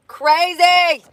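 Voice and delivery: high loud amazed repeat; amazed echo. Real, not acted. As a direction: say it again, still amazed. Echoing own word.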